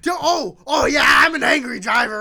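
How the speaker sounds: high, loud sarcastic protest; outraged, over-the-top comeback; indignant shout. Real, not acted. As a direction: shout it back in disbelief. Indignant retort to co-host's accusation.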